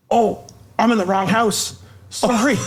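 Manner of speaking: high-pitched imitation of a startled apology. Stranger realises he is in wrong house, startled apology. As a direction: act out suddenly realising the mistake, voice lifted high. Intruder realises his mistake, retold.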